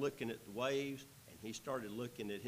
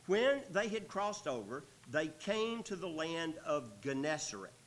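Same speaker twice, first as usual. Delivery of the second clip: higher, louder pulpit reading; raised Bible-reading voice; scripture reading; 5 semitones higher, 5 dB louder than usual. A narrator's reading. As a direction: read the verse out with projection. Reads scripture aloud.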